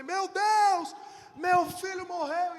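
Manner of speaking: high, loud, slow anguished wail; desperate cry. Retold or imitated. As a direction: wail it in despair. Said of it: "obviamente eu desesperei".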